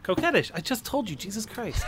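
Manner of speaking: high, fake impatient huff; mock exasperation. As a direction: act offended he had to repeat it. Mock exasperation about middle name.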